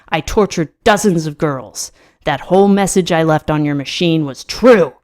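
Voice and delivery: loud, forceful confession; outburst. A narrator's reading. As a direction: insist on every word, punching the key ones. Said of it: "trying to ignore my outburst".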